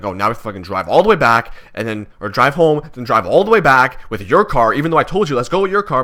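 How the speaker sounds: angrily telling mom off about the car; retold angry rant. Angry son ranting at his mom over the car, loud and exasperated.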